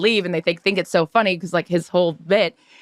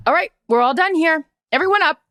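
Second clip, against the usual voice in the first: higher-pitched, brisk round-up of strangers; mock bossy announcement; 8 semitones higher than usual. Diner clears strangers from the booth, mock commanding. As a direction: order the whole booth up, playfully imitated.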